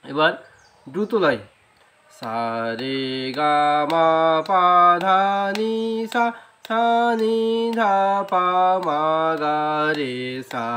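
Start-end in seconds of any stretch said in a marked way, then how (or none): 2.2-10.7 notes quick and close together; fast tempo sargam. Shown as a demonstration. Teacher demonstrating fast tempo, quick sung notes. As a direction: rattle through the scale at double speed. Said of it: "এটা হলো দ্রুত লয়".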